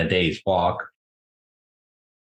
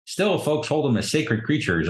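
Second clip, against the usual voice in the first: usual speaking voice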